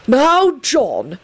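reproving headmaster. A narrator's reading. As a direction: chide him reprovingly. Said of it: "Dumbledore said reprovingly".

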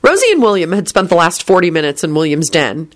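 usual speaking voice

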